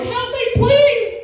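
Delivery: very high, slow wail; hammed-up terrified pleading; retold cry for help. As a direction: beg the cops in a drawn-out squeal. Calls cops in terror.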